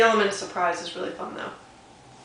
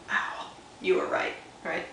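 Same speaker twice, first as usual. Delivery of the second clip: whispered big reveal; hushed reveal; 6 dB quieter than usual. Shown as a demonstration. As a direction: share the secret softly. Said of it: "reveal to kids".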